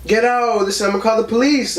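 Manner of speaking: high-pitched imitation of grandma yelling; retold yelling. Grandma warning off an intruder at the door, retold yell. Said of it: "grandma's yelling out".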